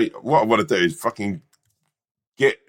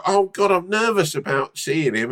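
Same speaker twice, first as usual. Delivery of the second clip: higher, jittery mock nerves; mock nervous friend; 6 semitones higher than usual. Friend imagined dreading the guru visit, mock nervous. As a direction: play the scared friend for laughs. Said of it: "And then you'd be like".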